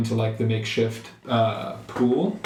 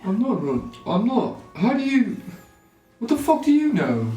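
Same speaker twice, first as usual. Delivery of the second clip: indignant; 10 semitones higher than usual. Acted. Player indignant, high.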